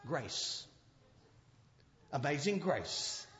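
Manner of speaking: sudden drop to a soft, low hush; hushed grace. Acted. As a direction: let amazing grace sink to almost nothing. Preacher drops to near-whisper on grace.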